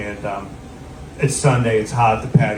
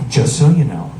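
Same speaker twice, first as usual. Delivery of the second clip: grave, measured imitation of a stern woman; very serious; 4 dB louder than usual. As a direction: make it a solemn warning. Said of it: "she gets very serious".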